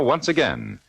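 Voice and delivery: ordinary talk, nothing marked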